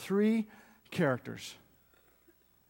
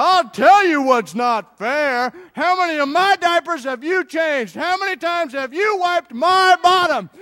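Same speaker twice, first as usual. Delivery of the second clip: much higher, loud ranting dad; dad launching into his speech, loud mock outrage; dad lecture; 10 semitones higher, 10 dB louder than usual. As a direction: let the indignant father rip. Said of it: "And the dad goes".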